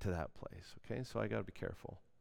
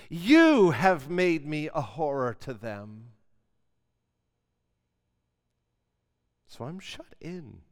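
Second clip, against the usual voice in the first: preacher reads the lament with heavy stress; emphatic reading; 3 semitones higher, 11 dB louder, slower than usual. Shown as a demonstration. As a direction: hit You and horror loud, slowing. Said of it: "see the word that I emphasize".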